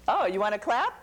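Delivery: usual speaking voice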